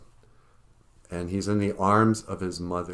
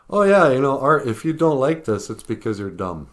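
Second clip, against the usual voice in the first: mock condescension; 5 semitones higher, 7 dB louder than usual. Mocking art establishment's condescension.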